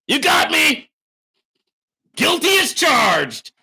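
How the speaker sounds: very high, loud sarcastic shout; mock hollered confession. Accused streamer sarcastically owning up, hollering. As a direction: holler a sham admission of guilt. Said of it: "you keep hollering".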